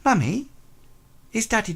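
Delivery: put-on high little girl's voice asking for daddy; child asking sweetly; young girl. Young girl asks her mother about daddy, high and childlike.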